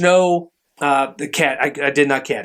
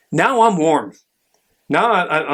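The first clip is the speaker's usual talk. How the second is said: usual speaking voice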